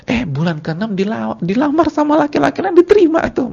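preacher marvels at the widow accepting a new proposal; mock astonishment. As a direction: gasp it out like unbelievable gossip.

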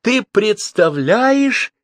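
high, slow indignant cry; high-pitched appeal, drawn out. A narrator's reading. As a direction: call it out in outraged disbelief. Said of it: "крикнула она бабушке".

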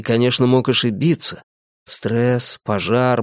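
ordinary delivery

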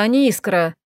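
usual speaking voice

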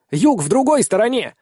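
high, loud shout over the wind; yelling over wind. Acted. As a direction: yell to be heard in flight. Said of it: "приходится орать".

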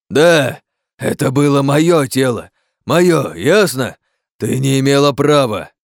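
loud, high, emphatic; angry protest. Acted. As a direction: assert your right, outraged. Furious quarrel over body.

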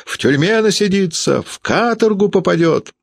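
frenzied high-pitched outcry; woman crying out. A narrator's reading. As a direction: shriek dire predictions without extra volume. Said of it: "и она кричала".

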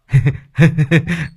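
high gloating chuckle; triumphant laugh. Acted. Servant gloating over the ghost, high laugh. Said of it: "đắc thắng cười hình hạch".

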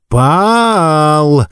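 drawn-out high call of the name; insistent teacher. A narrator's reading. Teacher jabbing the boy, calling insistently. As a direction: demand he answer. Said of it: "Костлявый палец снова и снова".

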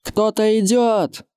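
high, drawn-out call from the wall; lookout's cry. A narrator's reading. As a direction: call out a slow, high-pitched alarm. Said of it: "Крик лучника".

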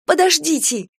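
high-pitched urgent cry; panicked plea. Acted. Kira rushing at the old woman, panicked. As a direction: plead with her to wait. Kira rushes to stop her.

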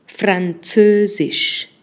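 Französisch stretched syllable by syllable, very slow; slow teaching demo of Französisch. Shown as a demonstration. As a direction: spell out Französisch slowly for learners. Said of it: "vamos a agregar el francés".

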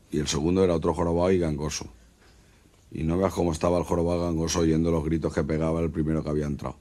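ordinary talk

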